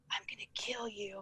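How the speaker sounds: a hushed, voiceless threat; whispered threat. Retold or imitated. Imitating the boy threatening the husband, in a whisper. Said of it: "And he's whispering".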